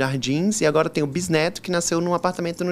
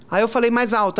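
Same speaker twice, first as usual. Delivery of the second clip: ordinary talk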